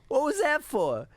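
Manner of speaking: high, loud put-on voice; quoting partner's line. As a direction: ask it in a hurt cartoonish tone. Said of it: "and he goes".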